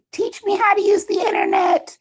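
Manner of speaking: high-pitched mock cry for help; helpless plea. New streamer begging viewers for help, high mock-helpless plea. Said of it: "so I'm constantly like".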